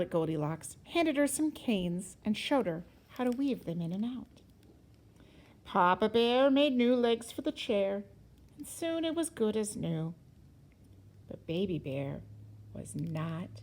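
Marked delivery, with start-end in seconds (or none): none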